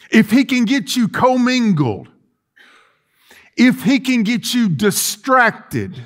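fervent pulpit preaching. Acted. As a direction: preach it, building intensity. Preacher builds against Satan's schemes.

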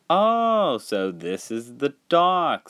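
high drawn-out "Oh", then easing down; haughty visitor P T Boomer. Acted. P T Boomer arriving at the docks, exclaiming. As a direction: exclaim it as a story character.

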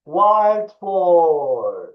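loud, high, drawn-out "Wild boar"; full teaching call, stretched vowels. Shown as a demonstration. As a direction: shout both words long for pupils. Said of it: "Biraz daha yüksek bir sesle".

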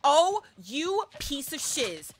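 ordinary talk, nothing marked

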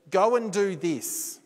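mock divine command. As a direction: order it like a booming voice from above. Imagined voice of God commanding.

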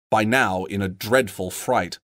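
ordinary delivery

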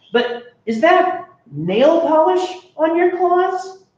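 put-on high, bright child narrator; a high kid voice, surprised and polite; young kid voice. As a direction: tell it as a wide-eyed kid. Kid asks monster.

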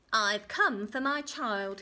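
slow, loud, menacing claim; little man's demand. Acted. Little man claims the baby, slow and demanding. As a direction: lean on each word, loudly.

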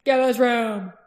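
loud command to clear space; shouted order. Acted. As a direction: bellow at the troops. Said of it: "he shouted".

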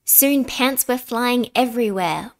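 ordinary delivery, nothing marked

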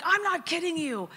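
insisting to her doubtful sister, fervent and high; insistent. Retold or imitated. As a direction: refuse to back down, pitch it way up.

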